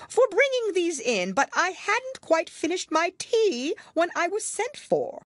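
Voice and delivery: high Hatter voice. A narrator's reading. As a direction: fluster through the excuse. The Hatter explains.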